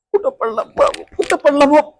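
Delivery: high, plaintive searching wail; hammed-up woe; distressed lament. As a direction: whimper over the lost phone. Lamenting the lost phone.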